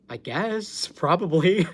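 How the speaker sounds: I guess, probably, breaking into laughter; laughing. Retold or imitated. Telling the stream her reply to Craig, laughing. Retelling awkward spanking question.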